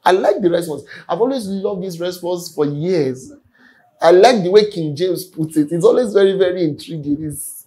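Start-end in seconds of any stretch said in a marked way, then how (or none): none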